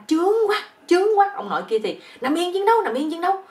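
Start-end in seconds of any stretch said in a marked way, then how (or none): 0.1-1.3 loud, very high-pitched mock female cry; odd crying out. Young woman crying out in bed, a comically odd high cry. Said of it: "con nhỏ này nó la". 2.2-3.4 high, loud mock battle order; yelling. Old army teacher to his bedmate, a hammed-up combat yell. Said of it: "ổng la lên".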